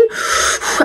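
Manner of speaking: long venting exhale; deep breath out. Retold or imitated. Mother cooling off outside the room, heavy exhale. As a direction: blow the frustration out in one breath. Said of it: "take a deep breath".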